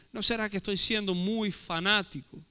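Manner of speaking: normal talking voice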